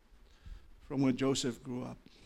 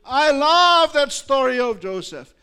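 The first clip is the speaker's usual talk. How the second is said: loud, much higher and slow declaration; impassioned preaching; 12 semitones higher, 15 dB louder than usual. Acted. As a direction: proclaim your love for the story. Preaching at full force.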